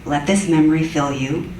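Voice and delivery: ordinary delivery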